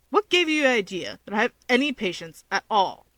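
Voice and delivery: usual speaking voice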